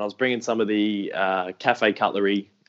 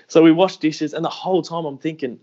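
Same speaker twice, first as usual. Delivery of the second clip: ordinary talk, nothing marked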